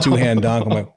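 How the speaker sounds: ordinary talk, nothing marked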